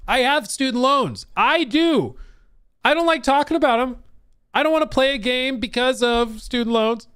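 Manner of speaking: high, loud whiny complaint; mock whiny voice. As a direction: whine like a sulky kid.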